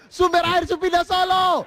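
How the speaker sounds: high, slow shouted heckle; mock heckler shout. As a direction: yell it from the back row. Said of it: "di belakang ada yang berteriak".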